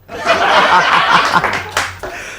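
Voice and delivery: breathy solo laugh; laughing alone. Real, not acted. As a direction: chuckle at own bad joke. Laughs alone at own joke.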